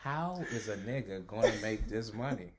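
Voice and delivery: a hint of Morgan Freeman telling a story; mock Morgan Freeman narration. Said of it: "that's his narrative voice".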